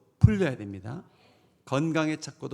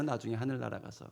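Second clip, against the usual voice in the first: ordinary delivery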